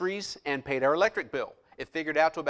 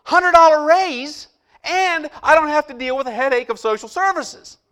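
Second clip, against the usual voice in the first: elated disbelief; 10 semitones higher, 10 dB louder than usual. Retold or imitated. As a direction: burst out in joyful disbelief. So I'm like.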